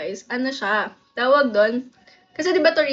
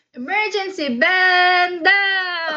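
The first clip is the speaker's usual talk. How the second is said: higher, very drawn-out call; emergency drill command; 5 semitones higher, 4 dB louder, much slower than usual. Shown as a demonstration. Showing classmates' drill command, high stretched call. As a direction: hold the last words long. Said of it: "Paano yung ganun?".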